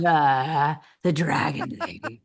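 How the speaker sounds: low, tired raspy Dragon Lady. Shown as a demonstration. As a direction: drop into a worn-out Dragon Lady voice. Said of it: "I have the Dragon Lady voice 'cause it starts like".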